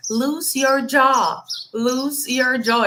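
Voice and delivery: very slow, drawn-out English coaching line. Retold or imitated. Harvard teacher telling Loris to relax her jaw, said slowly. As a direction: stretch each word out like a coach. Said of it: "ele falava assim".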